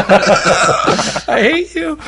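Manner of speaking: high, drawn-out words through laughter; helpless laughing mock hatred; laughing protest. Real, not acted. Speed reader's creator to teasing co-hosts, laughing. Teased, replies while laughing.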